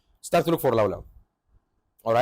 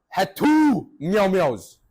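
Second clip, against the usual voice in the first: mock outrage, louder with TWO drawn out; slow, higher exasperated complaint; 5 semitones higher, 5 dB louder, much slower than usual. Storyteller fuming at the mall having two stores, exasperated.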